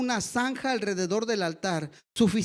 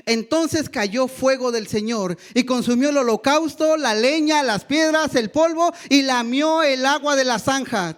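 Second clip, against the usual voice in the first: preaching at full force; 3 semitones higher, 6 dB louder than usual. Acted. Pastor reads the fire from heaven to the church, triumphant and loud. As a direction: build the fire falling to a climax.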